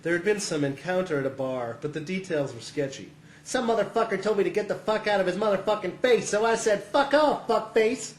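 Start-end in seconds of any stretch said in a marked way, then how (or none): 3.4-6.8 high, brash brother telling his fight story; excitable foul-mouthed storyteller; riled-up brother. Acted. Brother recounts his bar fight. 6.8-8.1 high-pitched mouthy brother; pitched-up taunt from a foul-mouthed brother; brash insult. Acted. Brother quotes his own insult.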